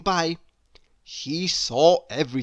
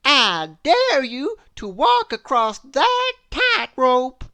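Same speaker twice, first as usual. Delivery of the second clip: daring; 9 semitones higher, 7 dB louder than usual. A narrator's reading. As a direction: lay down the challenge. Miss Trouble dares Brave.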